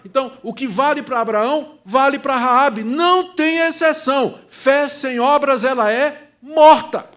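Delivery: building to a loud, high preaching shout. Real, not acted. Sermon climax, rising to a shout.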